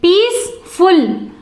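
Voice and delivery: Peaceful drawn out as Peace-ful with a gap; split into syllables. Shown as a demonstration. Teacher showing students two syllables, Peaceful split as Peace-ful. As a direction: say Peaceful slowly in two beats. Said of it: "these words have two syllables".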